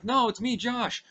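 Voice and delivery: put-on a scared kid begging to be believed; high pleading kid. Josh begging his friend to recognize him, high and pleading.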